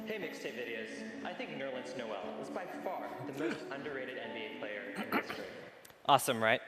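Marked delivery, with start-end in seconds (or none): 0.1-5.5 filtered voice over background music; processed app voice with a music bed. Shown as a demonstration. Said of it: "add things like voice filters and background music".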